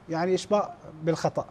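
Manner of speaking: ordinary delivery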